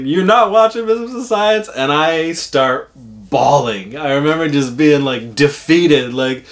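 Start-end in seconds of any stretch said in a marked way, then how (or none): 0.0-1.7 higher, louder mock taunt; teasing uncle. Prankster uncle taunting the boy about the show, teasing. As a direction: rub it in, playful cruelty. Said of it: "He's like".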